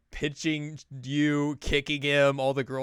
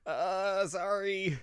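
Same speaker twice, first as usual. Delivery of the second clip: sheepish apology; 9 semitones higher, much slower than usual. Acted. Chie apologises after hitting Ai.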